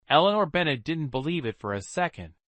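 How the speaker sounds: plain reading voice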